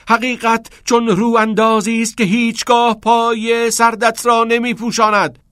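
sustained high, loud declamation; shouted verse. A narrator's reading. Pupil bursts out with his improvised poem, shouted verse. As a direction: hurl the poem out at full voice. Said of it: "داد زد".

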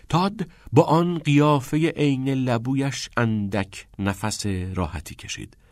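ordinary delivery

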